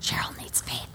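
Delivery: hushed, voiceless gossiping whisper. Retold or imitated. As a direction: whisper it behind someone's back. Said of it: "and I heard people going".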